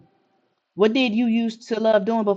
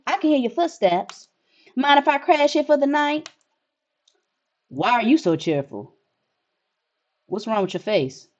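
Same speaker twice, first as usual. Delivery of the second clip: ordinary delivery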